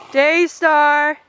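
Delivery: very loud, high shout across the field. Real, not acted. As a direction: call her from far away. Calling a far-off pony.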